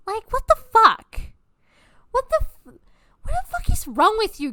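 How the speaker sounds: real exasperated disbelief; what the fuck. Real, not acted. Exasperated rant outburst.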